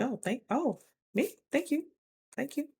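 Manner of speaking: bashful thanks. Retold or imitated. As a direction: breathe a shy, flattered thanks.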